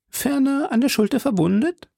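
very high-pitched landlady question; high, rising innkeeper voice; innkeeper woman asking. Acted. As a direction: ask as the helpful hostess, voice right up high.

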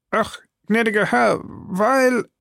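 high, anxious servant voice; timid pleading. A narrator's reading. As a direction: start a nervous excuse to your master. Scared servant pleading to his master.

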